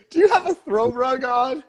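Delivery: mock high voice. Teasing a co-host, high put-on voice.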